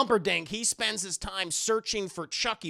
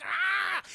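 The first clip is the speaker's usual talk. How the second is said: high charging yell; attack cry; 11 semitones higher than usual. Acted. Doll charges through the hallway, high acted cry.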